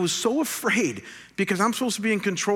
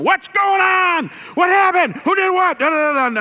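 high, very loud dad yelling questions; angry shouting; 9 dB louder than usual. Retold or imitated. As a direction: reenact a father's furious outburst at hurt kids. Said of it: "and I'm all angry".

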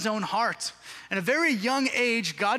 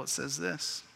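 ordinary talk, nothing marked

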